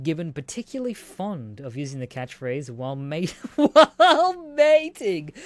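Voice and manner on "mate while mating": words broken by high laughter, slow; can't get the word out for laughing; laughing through words. Real, not acted. Reading about the duck mating.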